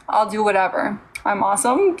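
ordinary delivery

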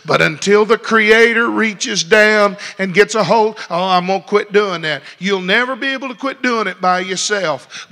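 loud, rising pulpit preaching; full-force Sunday preaching, each line hammered home. Acted.

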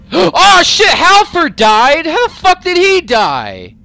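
very high, loud, slow stunned outcry; shocked disbelief. Real, not acted. Player finding his companion dead, slow high shocked outcry. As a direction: draw it out in shock.

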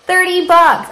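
high, loud delighted echo of the price; excited high echo; amazed repeat. Real, not acted. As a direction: say it again, still thrilled.

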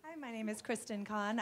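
ordinary delivery

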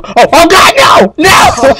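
high-pitched real yell of dismay; loud, high "no" of alarm; panicked shouting. Real, not acted. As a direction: cry out as disaster strikes. In-game mishap, cries out.

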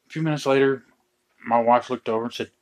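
normal talking voice

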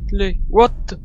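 frantic screams while fleeing; being chased. Real, not acted.

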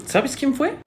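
high-pitched, rising question; eager question. Acted. First asking for the culprit.

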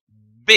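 the bare consonant B, lips pushed forward, no vowel after it; consonant sound demo. Shown as a demonstration. Teacher showing pupils the B sound, lips forward. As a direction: push the lips out and pop a short voiced B. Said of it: "qui s'avancent en prononçant ce son".

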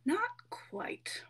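breathy high whisper. Acted. Ghostly cat whispers to Joyce, mysterious hush.

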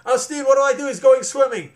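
loud high panicked question; scared rider calling to her friend; panicked shout. A narrator's reading. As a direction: yell it across the stream in alarm. Ellen panicking in the stream.

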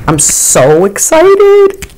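squealing excitement. Real, not acted. Vlogger squealing over a new lip gloss.

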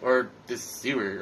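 ordinary delivery

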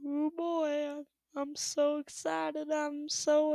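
slow, drawn-out, monotone mock excitement; feigned excitement. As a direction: drone it slowly, clearly not meaning it.

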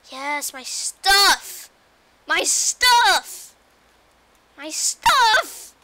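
joyful shouting; excited shout. Real, not acted. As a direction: yell with relief and joy. Finds lost items again.

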